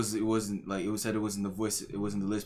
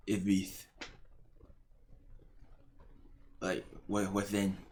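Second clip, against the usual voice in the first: lisp; 3 semitones lower, 7 dB quieter, slower than usual. Shown as a demonstration. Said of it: "if I started talking like this".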